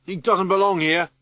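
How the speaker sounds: high, loud jeer from the crowd; hostile shout. Acted. Mob of children turning on Boris.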